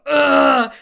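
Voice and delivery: loud imitated groan of disgust; big retold "ugh"; imitated friend's "ugh". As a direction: mimic a startled, grossed-out noise. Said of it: "she went".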